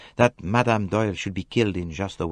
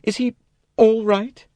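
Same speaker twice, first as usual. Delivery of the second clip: higher, urgent worry; anxious plea; 6 dB louder than usual. A narrator's reading. Jackie grabbing the doctor's arm, in an anxious higher voice. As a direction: beg for news of him.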